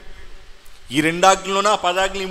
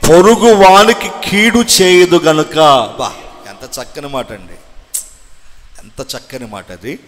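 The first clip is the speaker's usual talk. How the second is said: loud, slow, ringing sermon delivery; fiery preaching; 8 dB louder than usual. Acted. Preacher proclaiming the verse to his church, loud and fervent. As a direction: thunder the scripture at the pews.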